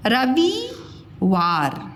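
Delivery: रविवार slowly as ra-vee-waar; गलत लंबी ई. Shown as a demonstration. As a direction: stretch वि in रविवार. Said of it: "रविवार नहीं बोलते हम". (रविवार को रवीवार खींचकर)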